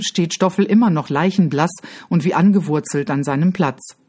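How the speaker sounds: plain reading voice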